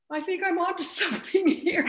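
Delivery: breaking into a laugh mid-line; laughing realization. Real, not acted. Flight attendant delighted at her own discovery, laughing. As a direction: giggle through the discovery.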